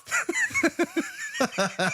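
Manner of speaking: cracking up at the brother chewing tobacco; high burst of laughter. Real, not acted.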